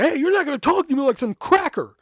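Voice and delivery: a retelling of the angry comeback, high and loud; snapping back. Retold or imitated. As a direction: fire the line back at the teacher again. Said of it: "That's when I fucking snapped".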